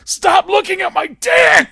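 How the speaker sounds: high, stretched-out full shout; loud mock-outraged holler; top-of-the-lungs yell. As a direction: scream it across an echoey room. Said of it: "in the top of my lungs".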